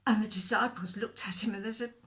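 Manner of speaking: ordinary delivery, nothing marked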